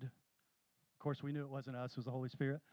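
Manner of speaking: ordinary talk, nothing marked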